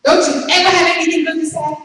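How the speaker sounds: loud, fast, high-pitched shout; retold shout. Speaker shouting back at a man complaining about life, exasperated shout. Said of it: "I shouted out back to him".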